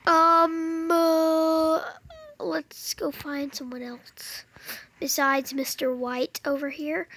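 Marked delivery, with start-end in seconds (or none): 2.4-4.4 slow whisper. Real, not acted. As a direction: whisper slowly, sneaking off. Sneaking away aside.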